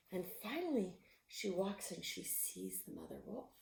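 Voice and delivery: plain reading voice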